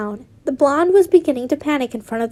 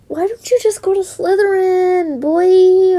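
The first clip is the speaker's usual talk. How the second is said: higher, teasing character voice; mock taunt with a crying edge; 6 semitones higher, 5 dB louder than usual. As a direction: sneer it at the boy, pitched up.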